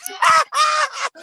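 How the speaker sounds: squealing high laugh; high shriek of laughter. Real, not acted. Cracking up at the gossip, high shrieking laugh. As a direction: burst out in a high cackle.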